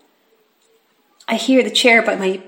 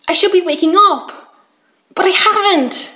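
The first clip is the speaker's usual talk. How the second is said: frustrated; 8 semitones higher, 4 dB louder than usual. Acted. Boy frustrated he cannot wake.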